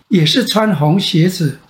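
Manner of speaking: ordinary delivery, nothing marked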